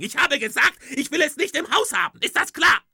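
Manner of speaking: very high, loud indignant wife; wife confronting Rick about the skeleton, very high and loud; outraged wife. Acted. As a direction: demand an answer at full pitch. Wife's shrill outrage.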